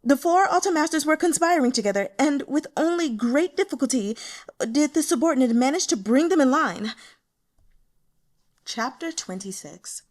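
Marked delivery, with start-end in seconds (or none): 0.0-7.0 high, loud and fast, still breathless; anxious subordinate pleading her case, loud and high; eager report. A narrator's reading. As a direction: rush the excuses out.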